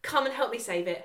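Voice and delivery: normal talking voice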